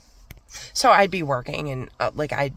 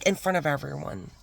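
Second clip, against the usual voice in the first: ordinary talk, nothing marked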